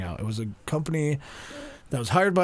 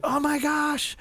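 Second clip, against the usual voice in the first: mock gushing on a much higher pitch; excited gushing; 14 semitones higher, slower than usual. Group at the table meeting the storyteller, excited gushing.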